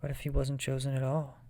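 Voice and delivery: plain reading voice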